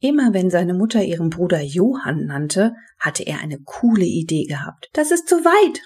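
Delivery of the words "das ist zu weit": high-pitched objection; calling out in protest. A narrator's reading. Mother objects to Johnny on the phone, exclaiming. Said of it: "rief seine Mutter ins Telefon".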